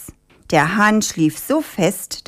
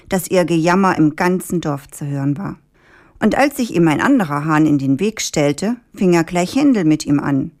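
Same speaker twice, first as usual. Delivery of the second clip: ordinary delivery, nothing marked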